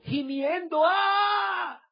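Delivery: high shouted groan of anguish; imitated groan. Pastor acting out the man at the altar, anguished groan. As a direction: let out a wailing groan like the weeping man.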